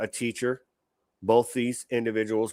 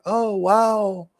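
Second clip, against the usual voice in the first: higher, louder mock-gushing celebrity fan; 7 semitones higher, 7 dB louder than usual. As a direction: ham up a starstruck gossip voice. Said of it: "people wanting to be like".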